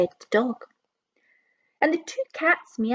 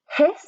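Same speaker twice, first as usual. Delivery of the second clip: higher, louder hissing goose; 7 semitones higher, 5 dB louder than usual. Acted. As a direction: hiss like an angry goose. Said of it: "said the goose".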